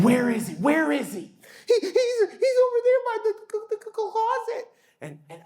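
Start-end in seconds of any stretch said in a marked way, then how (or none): 0.0-1.3 higher, eager mock search; playful dad. Dad playing along with daughter. 1.7-4.7 put-on high child voice with a nervous laugh; scared little girl. Daughter points out monster.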